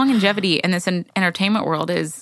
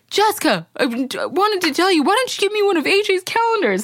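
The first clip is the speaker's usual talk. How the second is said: sheepish dad; 10 semitones higher than usual. Retold or imitated. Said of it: "he looks over and he goes".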